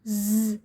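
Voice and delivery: ज़ as a buzzing z; phonic z sound. Shown as a demonstration. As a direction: buzz ज़ on its own. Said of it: "इसकी sound होती है ज़".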